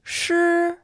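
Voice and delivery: Shi held high and level; first tone. Shown as a demonstration. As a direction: keep Shi flat and high. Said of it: "Here are the four tones".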